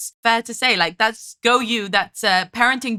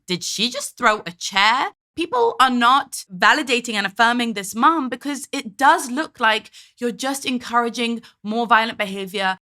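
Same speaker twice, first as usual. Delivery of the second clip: normal talking voice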